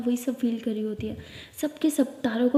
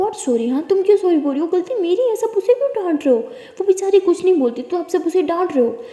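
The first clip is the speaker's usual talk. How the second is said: high, loud defiant protest; angry protest; 7 semitones higher, 6 dB louder than usual. Acted. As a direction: defend her, fight back. Sia defending Tara, arguing.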